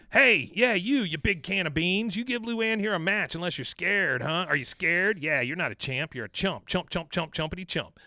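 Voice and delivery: loud, high taunting bully; trash talk Peggy. Retold or imitated. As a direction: shout the insults up at the ring. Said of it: "And then comes Shitty Peggy".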